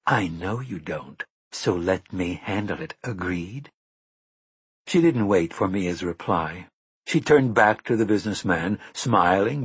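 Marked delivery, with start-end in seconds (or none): 0.1-3.7 quiet, level and firm; calm her down quietly; calm, low insistence. A narrator's reading. Susanna calmly insisting to Mia.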